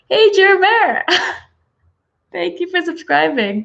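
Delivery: high bright call-out; excited greeting. Real, not acted. Greeting a new subscriber in the live chat, an excited call. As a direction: call out a happy hello.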